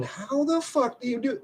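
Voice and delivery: high squeaky mock amazement; mock amazed high. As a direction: squeal the question in disbelief.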